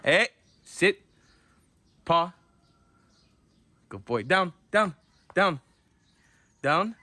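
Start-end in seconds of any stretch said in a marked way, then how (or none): 0.0-2.3 raised, higher command voice, repeated; stern repeated sit commands; firm dog command. Real, not acted. 4.2-7.0 raised, higher repeated command; stern dog training order; firm down command. Real, not acted.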